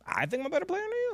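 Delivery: high, quick, soft disbelieving question; incredulous echo. Retold or imitated. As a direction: echo the accusation in a hushed, rising squeak. Retells his puzzled reply to her.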